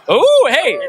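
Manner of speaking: high, loud burst of delight; delighted yelp. Real, not acted. Magician yelping as his card move lands, excited. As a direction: yelp at the trick working.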